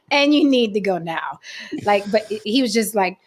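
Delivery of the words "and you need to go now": laughing dismissal. Retold or imitated. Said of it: "I was like".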